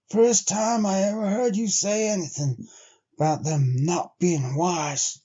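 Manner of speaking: a hint of a grizzled sled driver; Henry, a weathered trail man. Henry's lines in the story.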